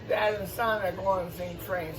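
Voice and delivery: plain reading voice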